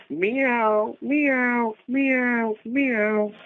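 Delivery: high-pitched imitation meowing; playful cat sound for a memory game. Shown as a demonstration. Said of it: "It's a cat".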